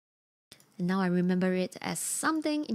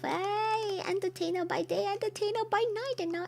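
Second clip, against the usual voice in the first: high, singsong mock announcer; playful hype intro; 10 semitones higher than usual. Streamer crediting the person who sent it in, playful hype. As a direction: hype them up in a high-pitched voice.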